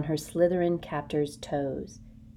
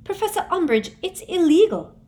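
high, loud, urgent protest; a desperate girl pleading; Hermione pleading; 13 semitones higher, 9 dB louder than usual. A narrator's reading. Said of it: "Hermione protesting".

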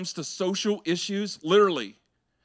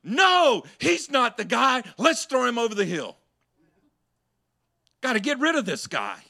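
angry crowd; 7 semitones higher, 6 dB louder than usual. Retold or imitated. Crowd voiced rejecting Jesus.

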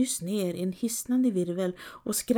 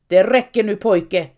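loud, stern growl; growled reprimand; 11 dB louder than usual. A narrator's reading. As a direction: growl a forceful order to stop. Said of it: "morrar Nils".

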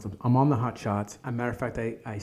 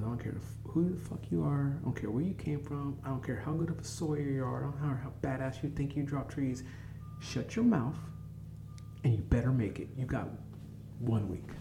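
ordinary talk, nothing marked

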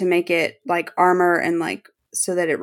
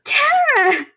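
hammed-up Drew outrage. Quoting Drew to the co-hosts, high, laughing mock shriek. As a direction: shriek it like a scandalized vampire. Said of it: "Drew says".

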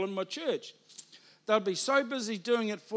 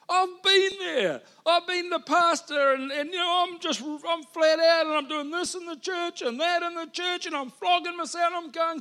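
frantic burnout rant; 8 semitones higher than usual. Retold or imitated. Preacher reliving his burnout, frantic and raised. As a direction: pour out the overwhelmed panic of burnout.

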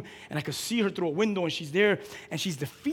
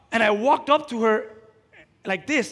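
ordinary talk

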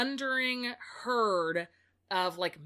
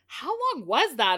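high, louder mock astonishment; hammed-up amazement, much higher; stunned question; 6 semitones higher, 7 dB louder than usual. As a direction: gasp it out in disbelief. Reader stunned by book length.

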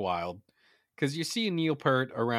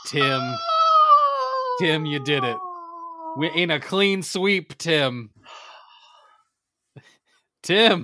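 loud, high cheering; excited shouting; 8 semitones higher, 7 dB louder, slower than usual. Real, not acted. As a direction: shout his name in triumph. Celebrating Tim's win.

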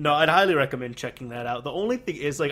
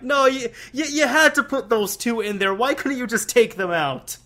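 higher, louder mock outburst; protesting in raised disbelief; exasperated protest; 8 semitones higher, 7 dB louder than usual. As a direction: replay an exasperated objection, pitched up and loud. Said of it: "and I'm like".

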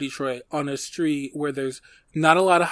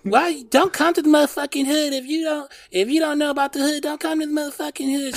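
Nene's scolding voice; 12 semitones higher than usual. Retold or imitated. Grandma scolding a nosy kid with a camcorder, high mock fussing voice. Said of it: "She was like".

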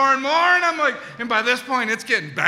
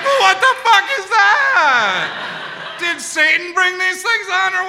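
panicked laughing question; 8 semitones higher, 4 dB louder, slower than usual. Retold or imitated.

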